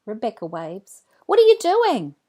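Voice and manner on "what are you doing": excited. Acted. Child calling across.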